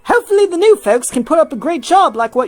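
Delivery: friendly hopeful helper, lightly acted; Alpha voiced. Alpha to Hilda at the zoo office, lightly voiced.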